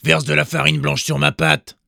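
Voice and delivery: loud order; commanding wolf. A narrator's reading. Wolf ordering the miller, loud and bossy. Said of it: "commanda-t-il".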